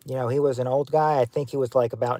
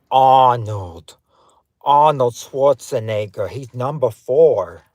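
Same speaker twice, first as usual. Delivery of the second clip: slow Austrian-accented action-star impression; Arnold Schwarzenegger; 2 semitones lower, 3 dB louder, slower than usual. Host confirming the guessed answer, Austrian-accented impression. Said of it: "who does that sound like".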